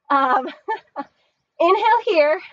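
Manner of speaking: ordinary talk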